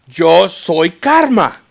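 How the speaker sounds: very loud, stretched-out proclamation; drawn-out triumphant shout; loud punchline reveal. Retold or imitated. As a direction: announce it slowly, like a big punchline.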